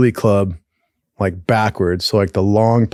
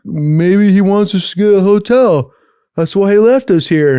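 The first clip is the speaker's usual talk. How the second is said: naive buddy; 8 semitones higher, 4 dB louder than usual. Retold or imitated. Stranded buddy guesses why cop left, mock-naive suggestion. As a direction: float a hopeful theory in a raised voice.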